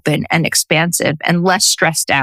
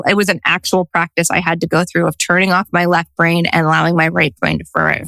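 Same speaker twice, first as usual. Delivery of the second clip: ordinary talk, nothing marked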